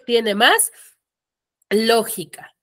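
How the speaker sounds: ordinary talk, nothing marked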